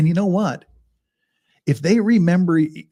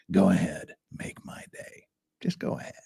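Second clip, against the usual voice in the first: Clint Eastwood; 8 semitones lower, 7 dB quieter than usual. Retold or imitated. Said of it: "if it's Clint Eastwood".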